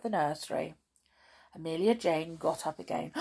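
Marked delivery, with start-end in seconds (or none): none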